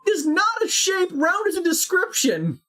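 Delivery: high, emphatic protest; insistent high. Real, not acted. Heated argument about shapes.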